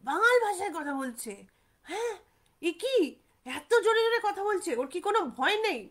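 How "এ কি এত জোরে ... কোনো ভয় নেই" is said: astonished, fearful. Retold or imitated. Boys amazed ghost talks loudly.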